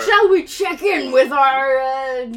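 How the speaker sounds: loud, stretched-out commentator voice; Nigel. Acted. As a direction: present it like a show host. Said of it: "Nigel comes over the, the commentary".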